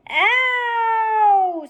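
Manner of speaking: long, very high howl of pain; pained scream. Acted. Roscuro screaming in pain, long high howl. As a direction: scream as the tail goes. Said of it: "screamed Roscuro".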